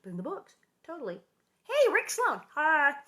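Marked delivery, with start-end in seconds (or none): 1.6-3.0 high, loud hello to an arriving viewer; calling out greeting. Real, not acted. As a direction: hail someone you just spotted. Greets viewer who just joined.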